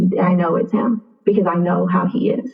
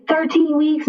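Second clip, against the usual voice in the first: incredulous question; 9 semitones higher, slower than usual. Retold or imitated. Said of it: "He was like".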